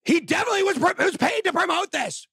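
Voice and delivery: host mimics critics who accused him; mocking critics. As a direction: parrot the haters' claim. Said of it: "and said".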